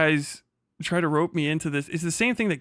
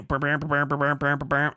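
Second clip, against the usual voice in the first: imitating a phone ringing; 3 dB louder than usual. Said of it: "And then you go".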